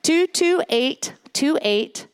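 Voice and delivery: two two eight two eight, each digit slow and separate; carefully spaced two two eight two eight; slowly repeated number. Shown as a demonstration. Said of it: "I will repeat this".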